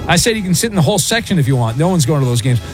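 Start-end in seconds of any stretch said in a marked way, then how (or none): none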